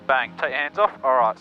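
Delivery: ordinary talk, nothing marked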